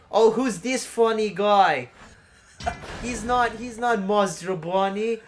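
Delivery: put-on high, slow Italian accent; slightly Italian lilt. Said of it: "slightly Italian there".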